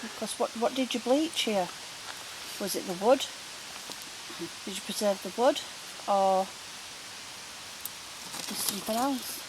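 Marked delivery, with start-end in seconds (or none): none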